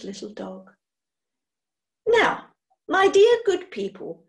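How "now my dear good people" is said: brisk, high and loud, taking charge. A narrator's reading. Witch posing as welfare worker addresses the household briskly. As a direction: breeze in like an officious social worker. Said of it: "inquired Hecky briskly".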